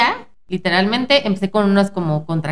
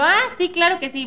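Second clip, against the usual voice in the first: mock sweet agreement; 8 semitones higher than usual. Feigned agreement to doctor.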